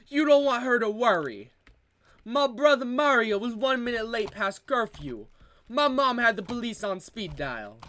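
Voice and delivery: broad mock Italian-American accent, playing a wiseguy. Comedian riffing on family life in an Italian accent. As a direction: lay on a thick Italian accent like a mob cousin. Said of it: "the Italian accent".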